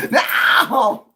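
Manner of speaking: very high, loud wail. Real, not acted. Overwhelmed by Tony's loss, a loud wail. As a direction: let out a theatrical wail of grief.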